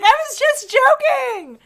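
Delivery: very high, loud, slow silly voice; mimicking son. Mother mimics son brushing off his joke, high and drawn out. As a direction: stretch it out like a goofy kid.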